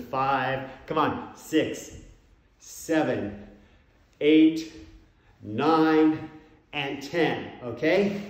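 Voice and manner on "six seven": ordinary talk, nothing marked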